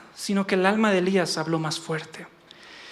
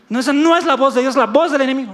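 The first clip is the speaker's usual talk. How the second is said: protesta; 5 semitones higher, 7 dB louder, faster than usual. Acted. Acted protest of resisting believer.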